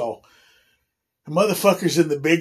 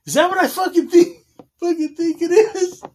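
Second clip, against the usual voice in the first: much higher, fast incredulous question; shocked disbelief; 11 semitones higher than usual. Retold or imitated. Staring at a roommate's hickeys, high and shocked.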